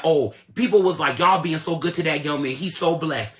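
loud, heated rant; furious shouted tirade at the liar; angry yelling. Real, not acted. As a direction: blast him with every word. Ranting at him, voice raised.

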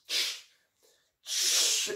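long voiceless shushing hiss; imitated firework hiss. Storyteller mimics a dud firework, imitated hiss. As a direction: make the hiss of a sparking firework.

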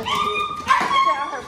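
high, loud urgent yell; high shout. Real, not acted. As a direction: shout the dog off it. Dog has something in mouth.

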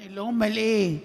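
ordinary delivery

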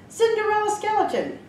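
high exclaiming witch voice. A narrator's reading. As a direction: call her name like a good witch. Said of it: "the witch exclaimed".

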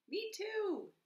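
very high, loud mouse voice; excited squeak of a storybook animal; eager field mouse. A narrator's reading. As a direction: pipe up high as a little mouse.

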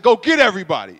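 rally the troops; loud order. Retold or imitated. Big brother tells his friends to gather everybody, loudly.

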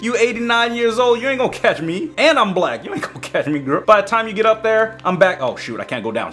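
ordinary talk

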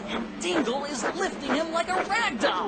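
high, rising, amazed broadcast call; excited announcer. Acted. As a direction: sell the miracle to the audience. Reporter announces the amazing feat live.

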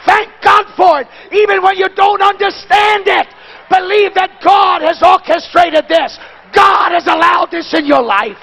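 high, loud and slow preacher's cry; full-force preaching. Acted. Preacher telling them to thank God, slow loud preaching. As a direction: call it out over the crowd.

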